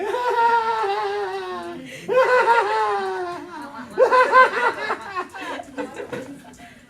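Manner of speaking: long, loud, high cackling laugh; gleeful baby laugh. Retold or imitated. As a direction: laugh like a kid who won. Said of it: "the little kid's like".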